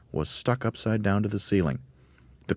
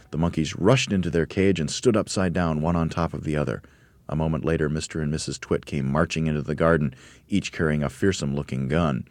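usual speaking voice

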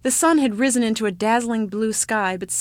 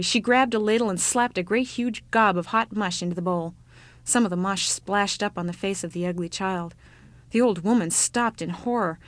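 ordinary delivery

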